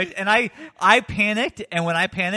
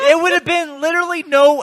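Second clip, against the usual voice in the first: louder and higher, laughing in disbelief; exasperated laughing outburst; 9 semitones higher, 8 dB louder than usual. Real, not acted. Laughing at himself over the bag Kevin could have brought, loud exasperated outburst. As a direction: blurt it out, half laughing at yourself.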